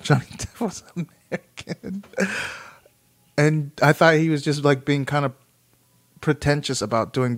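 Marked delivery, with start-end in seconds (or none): 0.0-2.0 high, breaking into laughter; laughing. Real, not acted. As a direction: laugh through your own embarrassing confession. Laughing at own long misunderstanding.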